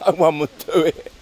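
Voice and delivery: can't hold back laughter; real laughter breaking in; laughing. Real, not acted. Laughing while telling story.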